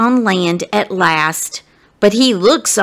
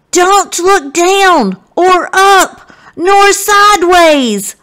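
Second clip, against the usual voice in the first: slow loud high holler; 9 semitones higher, 5 dB louder, slower than usual. A narrator's reading. Austin hollers to stuck Abe, slow loud holler. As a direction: holler the warning across the creek. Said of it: "Austin hollers".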